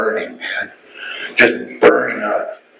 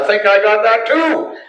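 higher, laughing as he says it; laughing reply; 10 semitones higher than usual. Retold or imitated. The bearded man answering, laughing higher. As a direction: let a laugh slip into the words.

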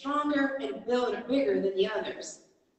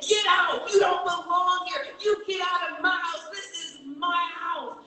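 shout; 8 semitones higher than usual. Shown as a demonstration. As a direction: throw the devil out of your house with a shout. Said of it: "you got to say".

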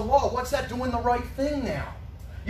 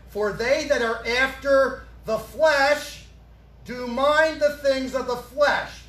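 slow, louder scripture reading; 3 semitones higher, 4 dB louder, slower than usual. Shown as a demonstration. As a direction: stretch out every word of the verse. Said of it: "Let's read this slowly".

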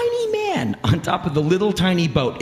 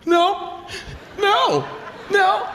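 high-pitched mock refusals; short high no's, mimicking a rejection; much slower than usual. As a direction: turn them down in a high mimicking voice. Refusals voiced as retold reply.

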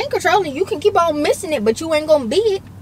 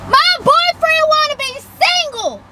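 slow, much higher and loud public announcement; calling out loud; 10 semitones higher, 3 dB louder, much slower than usual. Real, not acted. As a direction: broadcast it so everyone hears. Announcing his words to everyone.

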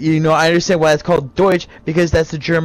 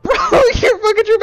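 high, fast laughing jab; laughing mockery; 13 semitones higher, faster than usual. Real, not acted. Teasing Bradley over his German claim, high fast laughing. Mocking friend during argument.

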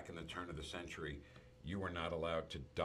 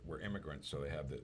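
ordinary talk

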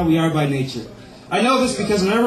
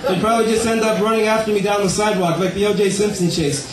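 ordinary delivery

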